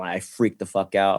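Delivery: ordinary talk, nothing marked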